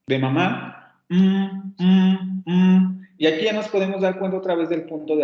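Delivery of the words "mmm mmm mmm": M hummed alone through closed lips; nasal M demo. Shown as a demonstration. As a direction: sustain a higher humming "mmm" for each M. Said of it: "los ejemplos que tenemos en español serían la M".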